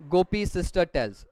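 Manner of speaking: usual speaking voice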